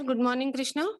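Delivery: ordinary talk, nothing marked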